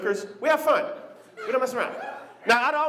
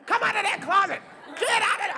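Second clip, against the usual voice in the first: high, yelling at the closet door; furious dad yelling; 13 semitones higher than usual. Retold or imitated. Chuck yelling at his crying son in the closet, high furious shout. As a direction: scream at the kid to come out. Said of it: "Chuck really loses it".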